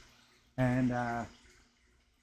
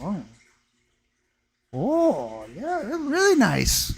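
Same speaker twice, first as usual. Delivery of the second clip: imitated voice rising much higher in admiration; impressed stepmom; 7 semitones higher, slower than usual. Dad's wife now admiring the sphere, higher imitated voice. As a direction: lightly mimic her sudden interest.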